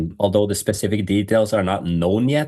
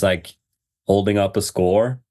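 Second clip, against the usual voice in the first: usual speaking voice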